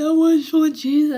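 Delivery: loud, high anguished cry. Real, not acted. Man reliving his cell breakdown, cries out loud and high. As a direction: let it burst out with longing.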